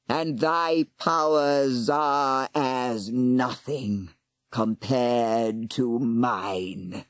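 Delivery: very slow, vast serpent voice; ancient serpent. Acted. Serpent addressing the tiny humans, slow and vast. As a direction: speak like an old god.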